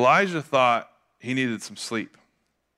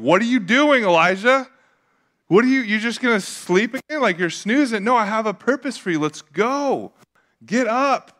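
acted high-pitched, incredulous scolding; exasperated rousing; 9 semitones higher, 5 dB louder than usual. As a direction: rouse a sleeper with impatient urgency. God acted out rousing sleepy Elijah.

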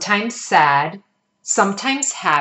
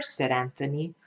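ordinary delivery

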